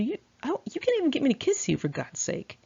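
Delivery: high, fast mocking boyfriend. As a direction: squeak out his complaint as a mock. Retold boyfriend complaining in car.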